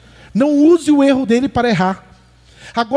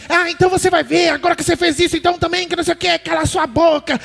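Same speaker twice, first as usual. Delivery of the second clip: higher, mock-spiteful jab at the husband; ironic threatening wife; 5 semitones higher than usual. Wife taunting her husband after his mistake, mock spiteful. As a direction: sneer it out like a wife spoiling for a fight. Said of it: "Não é falando assim".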